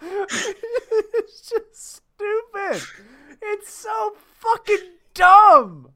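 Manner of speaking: high, slow words strained through real laughter, rising loud on the last; crying-laughing at how dumb it is; laughing exasperation. Real, not acted. Reacting to absurd news with his co-host, real helpless laughter.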